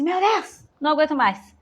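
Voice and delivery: high, loud exasperated cry. Retold or imitated. Worn-out parent at the breaking point, high and loud exasperation. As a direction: throw up your hands, at the end of your rope.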